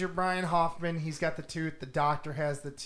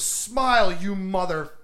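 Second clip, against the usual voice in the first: lightly acted action-hero line, louder start; retold defiant one-liner; 7 dB louder than usual. Heroine to the werewolf before firing, mock defiant. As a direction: punch out 'Smile' like a hammy heroine. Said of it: "she goes".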